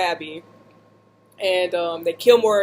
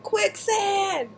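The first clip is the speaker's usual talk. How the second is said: much higher cry across to a friend; calling out; 12 semitones higher than usual. Retold or imitated. Sinking in the bog, calling out to her friend for help. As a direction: yell it out for help. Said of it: "I call out to my friend".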